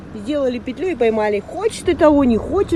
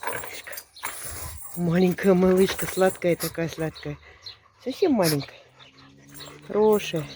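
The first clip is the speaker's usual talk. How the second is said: talking to a dog; 5 semitones lower, 3 dB quieter than usual. Real, not acted. Cooing softly at puppy.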